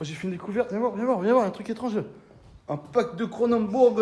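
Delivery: called out high and loud; calling a friend over from afar; yelling across the room. Real, not acted. Appelle son ami pour une découverte. (appel crié à travers la pièce)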